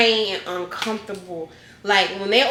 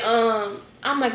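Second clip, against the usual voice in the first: ordinary talk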